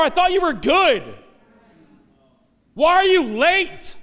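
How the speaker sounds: high, loud, hurt complaint to God; a believer demanding why God came too late; accusing God. Retold or imitated. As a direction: confront God with wounded anger. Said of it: "sitting in the middle going".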